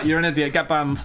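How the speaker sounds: ordinary delivery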